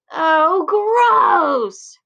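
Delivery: high, loud yell of disgust. Acted. Boy yelling when the frog soils him, loud disgust. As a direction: shout in total revulsion. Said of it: "Frankie yells".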